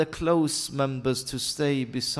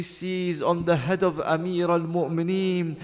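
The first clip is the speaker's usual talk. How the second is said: loud, high preaching building to a wail; grieving preacher at full, rising force; impassioned lament sermon; 11 semitones higher, 12 dB louder than usual. Real, not acted. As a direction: cry out the tragedy to a mourning congregation.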